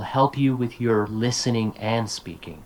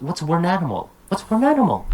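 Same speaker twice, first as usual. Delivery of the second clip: imitating a confused learner, pitch jumping up; puzzled mock question, "were an animal" as one odd word; baffled student; 5 semitones higher, faster than usual. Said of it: "I don't understand them".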